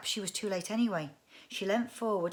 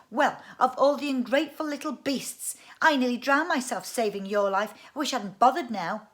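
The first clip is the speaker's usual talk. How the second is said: put-on higher, louder offended girl; indignant scolding; 5 semitones higher, 9 dB louder than usual. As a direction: scold him, hurt and cross. Girl scolds ungrateful brother.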